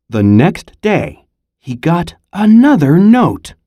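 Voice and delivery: ordinary delivery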